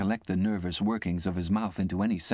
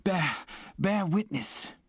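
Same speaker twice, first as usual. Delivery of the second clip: a frightened convict's high, breathless pleading; gasping in fear; 9 semitones higher than usual. A narrator's reading. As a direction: gasp out the accusation in terror. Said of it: "The other one still gasped".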